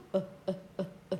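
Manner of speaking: normal talking voice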